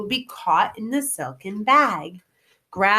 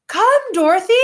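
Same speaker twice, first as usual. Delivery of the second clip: higher, louder hurried call; urgent call; 11 semitones higher, 7 dB louder than usual. Acted. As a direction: call her over before it flies. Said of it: "cried the wizard".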